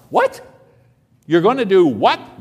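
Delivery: outraged astonished What; shocked disbelief. Acted. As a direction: react as if you can't believe your ears. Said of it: "that's exactly what Habakkuk responds".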